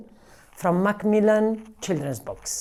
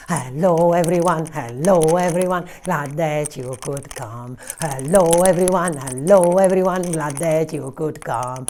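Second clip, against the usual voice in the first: quick, lively chant; the fast take of the greeting song; faster; faster than usual. Shown as a demonstration. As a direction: speed the greeting up. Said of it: "maybe we can do it faster".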